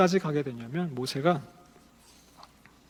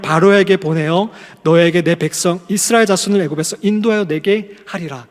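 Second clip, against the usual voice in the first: loud, declaiming scripture reading; scripture read aloud; 3 semitones higher, 8 dB louder than usual. Acted. Preacher reading God's call to Moses, loud and solemn. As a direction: proclaim God's words over the congregation.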